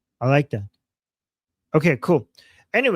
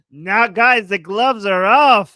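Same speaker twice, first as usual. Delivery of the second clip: mock dramatic; 4 semitones higher, 10 dB louder, slower than usual.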